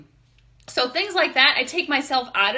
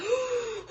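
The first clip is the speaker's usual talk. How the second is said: gasp; 13 semitones higher, 6 dB quieter than usual. Retold or imitated. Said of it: "I was like".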